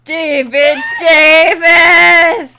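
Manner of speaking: slow drawn-out announcer call. Pretend. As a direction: boom the name out slowly. Slow deep drawn-out name call.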